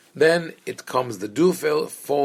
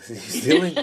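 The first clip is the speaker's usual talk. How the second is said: laughing through the words; 11 semitones higher than usual. Real, not acted. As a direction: break into laughter mid-phrase. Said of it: "laughing at own joke".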